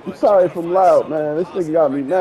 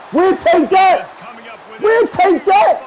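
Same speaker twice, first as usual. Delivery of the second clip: gloating shout; 12 semitones higher, much slower than usual. Real, not acted. Gloating after a turnover.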